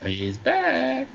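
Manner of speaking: high, drawn-out mock-eerie call; singsong announcement. Caller playfully echoing host that he is back, singsong.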